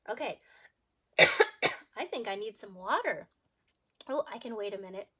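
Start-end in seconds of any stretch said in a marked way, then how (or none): none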